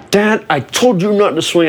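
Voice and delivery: high, strained mock scold at dad; exasperated scolding. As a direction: blame him with a cracking voice. Bleeding son scolding dad.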